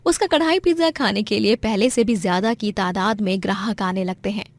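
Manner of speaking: plain reading voice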